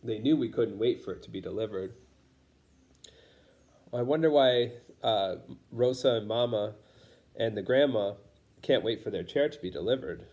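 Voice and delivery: ordinary delivery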